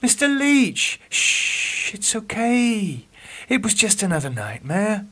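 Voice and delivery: calm him after a nightmare; soothing nurse. Acted. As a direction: night nurse comforting her patient.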